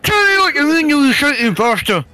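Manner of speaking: high, mushy speech through squashed cheeks; comically garbled Scottish insult; squeezed cheeks. Pretend. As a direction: talk as if someone is squeezing your cheeks. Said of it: "I squeeze his cheeks together".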